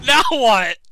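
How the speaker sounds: ordinary talk, nothing marked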